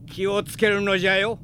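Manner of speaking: put-on old master calls out his blessing; calling after him. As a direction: send the words after the vanished pupil. Old teacher calls after pupil.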